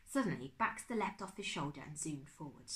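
ordinary delivery, nothing marked

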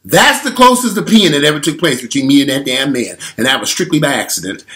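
ordinary talk

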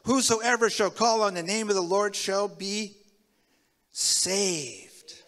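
loud, ringing preacher proclamation; booming scripture reading from the pulpit; preaching scripture. Acted. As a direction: proclaim it to the whole church.

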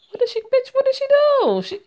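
very high, loud shriek of disbelief; outraged outburst. Real, not acted. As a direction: screech it at the book in protest. Reader erupts at the character's complaint.

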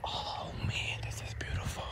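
soft whispered wonder; quiet whispered awe; awed whisper. Real, not acted. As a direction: breathe it out in a whisper.

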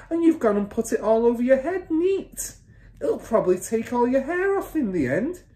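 high-pitched exasperated scolding; mother's cry. A narrator's reading. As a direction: berate a stupid husband. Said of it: "the mother cried".

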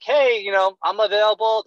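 sing-song mock salesman; robotic salesman mockery. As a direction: parody a canned sales greeting. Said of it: "This is seriously how you sounded".

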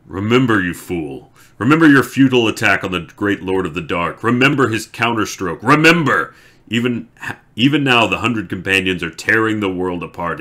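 the betrayer rages and gloats at his enemy; furious taunting. Acted. The betrayer taunts Lews Therin.

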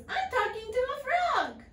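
high, loud disbelief; amazed princess. A narrator's reading. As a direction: marvel at a talking frog. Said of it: "said the princess".

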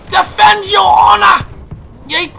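high, loud, drawn-out holler; mock battle cry. Player rallying the squad in a game, hammed-up shout.

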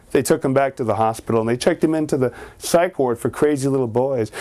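ordinary delivery

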